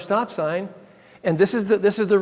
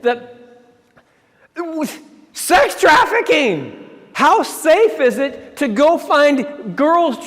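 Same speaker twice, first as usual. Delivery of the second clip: slow, high, louder drawn-out phrase; reluctant boss word; 7 semitones higher, 4 dB louder, much slower than usual. Retold or imitated. Administrator finally naming the trip's aim, slow and loud. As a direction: force the word out slowly.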